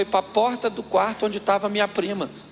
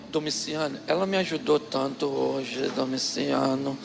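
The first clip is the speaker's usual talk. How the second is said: lower, softer nostalgic coaxing; sweet-talking; 6 semitones lower, 4 dB quieter than usual. Retold or imitated. Aunt softly coaxing husband about their youth. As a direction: charm him with memories. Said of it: "o tom de voz da minha tia mudou".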